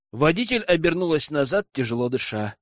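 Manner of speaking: plain reading voice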